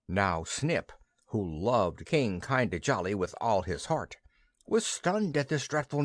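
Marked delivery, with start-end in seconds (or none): none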